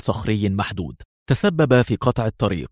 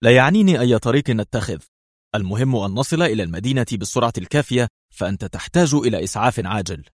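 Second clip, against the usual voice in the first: plain reading voice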